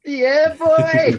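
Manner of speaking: loud high whoop of celebration; excited shout. Real, not acted. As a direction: yell it out triumphantly. Celebrating league title, shouting.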